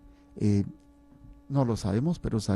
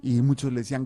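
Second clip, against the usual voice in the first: usual speaking voice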